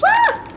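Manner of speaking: sudden high squeal of delight; excited squeal. Real, not acted. Unboxing doll sunglasses, squealing with delight. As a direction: let out a short thrilled squeal.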